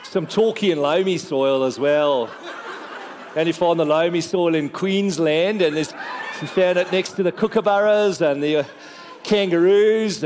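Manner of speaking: jokey put-on Australian accent; playful Aussie impression with stretched vowels. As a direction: ramble on like a mock Australian.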